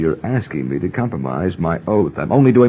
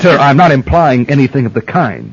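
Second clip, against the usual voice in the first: raised, higher, insistent denial; a general protesting to a senator, firm and raised; 7 semitones higher, 5 dB louder than usual. Acted. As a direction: push back hard against the accusation.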